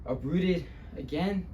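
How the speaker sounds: subdued dramatic storyteller; weighted pauses, building on the last word; Luis Rodriguez. Acted. Luis recalling eviction and isolation, subdued then bitter. Monologue continues, more subdued.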